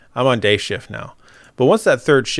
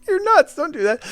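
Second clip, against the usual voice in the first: incredulous scolding. Retold or imitated.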